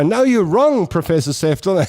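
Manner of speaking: a hint of a stubborn caller; mother arguing. Mother contradicting the professor on air, mildly mock stubborn. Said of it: "she used to go".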